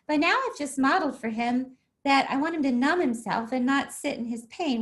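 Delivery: normal talking voice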